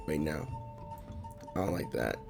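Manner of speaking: ordinary talk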